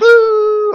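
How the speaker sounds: a high whooping horn blast; horn imitation. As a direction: toot it out like a trumpet.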